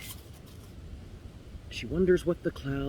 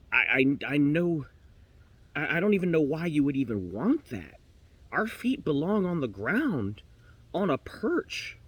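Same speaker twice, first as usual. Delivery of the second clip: flustered protest; 2 semitones higher, 3 dB louder than usual. Acted. Canary argues against flying, flustered.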